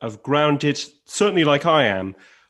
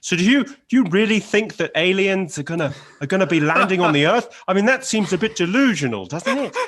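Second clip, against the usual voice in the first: mocking early Louis; 6 semitones higher, 3 dB louder than usual. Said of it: "It's like".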